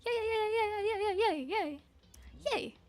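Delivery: high, drawn-out squealed yays; self-cheering. Pretend. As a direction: cheer like your own little crowd, high and playful. Streamer cheers own name in intro.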